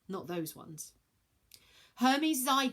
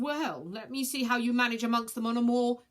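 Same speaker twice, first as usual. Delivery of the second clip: stern judge; 4 semitones higher, 6 dB louder than usual. Acted. Council member stern.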